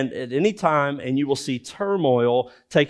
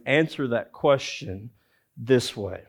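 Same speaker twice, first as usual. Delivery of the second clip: usual speaking voice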